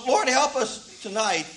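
ordinary talk

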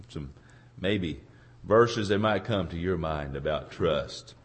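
ordinary delivery

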